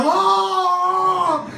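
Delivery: high, long, hammed-up wail; loud wail. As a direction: wail it out at full volume. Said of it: "I let out a wail".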